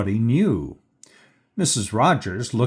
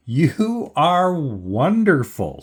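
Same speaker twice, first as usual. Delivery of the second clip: slow, higher-pitched gushing; delighted praise; 5 semitones higher, 3 dB louder, slower than usual. A narrator's reading. Guest hugs maid, delighted after laughing.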